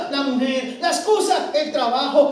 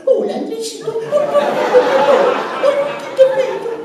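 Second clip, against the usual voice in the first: put-on high, squealing baby talk, higher; mock baby talk; 11 semitones higher, 5 dB louder, much slower than usual. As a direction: absurdly coo at a grown man. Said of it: "si yo fuera a hablar con Andrés". (saludando a un adulto con voz aguda de bebé, en broma)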